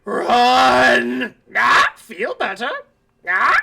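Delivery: loud creature shriek. Acted. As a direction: screech it out like a monster. GM voices creature's cry.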